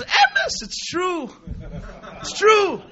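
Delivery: high-pitched exclamation; fervent affirmation. Retold or imitated. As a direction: burst out in acted conviction.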